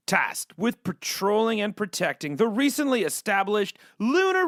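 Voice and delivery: dramatic movie-trailer read; trailer narration. Acted. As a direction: sell it like a sci-fi trailer. Reads the game's promo blurb.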